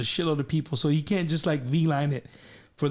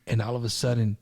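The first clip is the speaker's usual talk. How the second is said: normal talking voice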